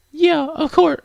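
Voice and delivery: very high, girlish put-on voice; high-pitched game girl voice; high girl character. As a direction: answer in a high anime-girl falsetto. Wrong character's voice, then redone lower.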